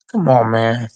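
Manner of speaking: ordinary talk, nothing marked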